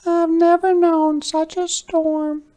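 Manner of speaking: squeaky mouse voice. Acted. Mother Mouse frets to Hedgehog in a high squeak. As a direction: squeak it like a worried little mouse. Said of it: "squeaked Mother Mouse".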